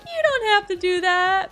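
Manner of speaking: high, drawn-out coy protest; mock-modest singsong demurral; playful bashfulness. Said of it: "playful bashfulness where you're like".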